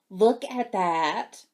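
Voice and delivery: normal talking voice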